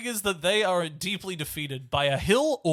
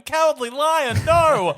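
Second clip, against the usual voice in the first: high, loud, drawn-out mock yell; mock shout; 10 semitones higher, 10 dB louder, slower than usual. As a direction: yell it at him like a scolded pet. Dorothy mock-yelling at Lion.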